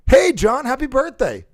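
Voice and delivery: loud, very high birthday shout; tone for a friend. Shown as a demonstration. Calling John on his birthday, loud and excited. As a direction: holler it excitedly. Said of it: "a different tone for different people".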